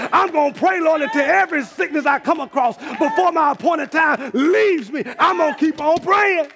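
steady rising preacher's cadence, praying aloud; preaching at full force. Acted. Preacher urging his congregation to keep praying, in full preaching cadence. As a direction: preach it to the congregation, building fervour.

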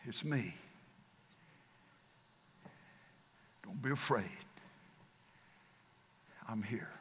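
low, very soft, ending in a whisper; whispered reassurance. Acted. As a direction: breathe the words like a secret comfort. Jesus speaking in the storm.

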